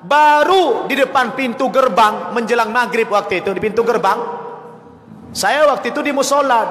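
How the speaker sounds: ordinary talk, nothing marked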